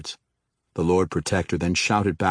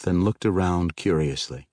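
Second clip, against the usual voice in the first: ordinary delivery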